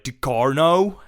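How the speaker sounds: Ducarno said loud and high, rising, the American way; Americanized name. Shown as a demonstration. Co-host settling the name with an American accent. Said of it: "Let's just go America".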